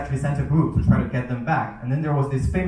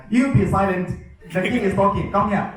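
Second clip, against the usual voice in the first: imitating bossy royal guards, higher voice; giving attitude; 6 semitones higher than usual. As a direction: order the teacher around like a mock king's guard. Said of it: "they were giving me attitude".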